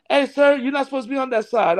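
lightly acted, urgent objection; calling out a challenge. Man rushing out to stop him.

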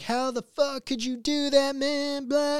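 high, mocking outraged voice; outraged Twitter user. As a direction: mimic someone fuming over nothing. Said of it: "People are gonna be like".

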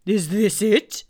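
put-on loud, slow, hooting old owl. Owl greeting Pooh, loud slow hooting owl voice. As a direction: boom it out like a pompous owl. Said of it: "said Owl".

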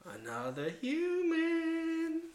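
slow, drawn-out rising crow; gloating goblin cry. Acted. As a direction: stretch it out high in mock triumph. Player gloats as goblins over kill.

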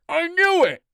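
high, urgent shout of discovery. A narrator's reading. Eagle has found the runaways, shouting. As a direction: shout it out, pitch way up. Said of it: "Eagle shouts".

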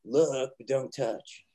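Pacino quote. Retold or imitated. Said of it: "what does Pacino say".

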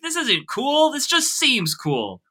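high-pitched, hammed-up indignation; mock old-timer rant. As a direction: pretend outrage at the youngsters, dropping at the end. Mock rant about new kids.

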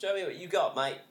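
put-on British-Australian accent. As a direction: toss out an Australian challenge, mate. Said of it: "You gotta add the mate".

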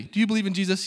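bold question pitched well up. Retold or imitated. Missionary repeating his question to the man, retold boldly. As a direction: ask it boldly and directly. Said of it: "Very boldly".

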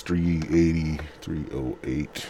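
normal talking voice

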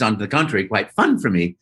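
usual speaking voice

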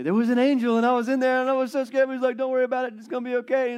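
bursting to finally tell the story; giddy old man spilling everything; excited retelling. Acted. Zechariah excitedly retelling the angel.

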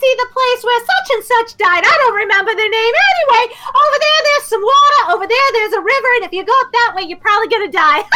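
high, fast, careless rattling; kid tour guide. Acted. Rickshaw kid rattles off a tour while running, high and fast. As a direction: rattle it off over your shoulder like a kid. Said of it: "tossing over his shoulder".

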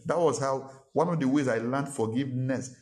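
normal talking voice

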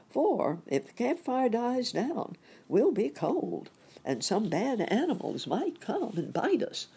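cautioning. A narrator's reading. Warning Sammy about the fire.

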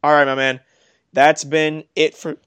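hiccuping while talking; words broken by hiccups. Real, not acted. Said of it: "got the hiccups".

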